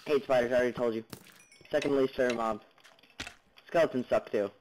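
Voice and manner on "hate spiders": usual speaking voice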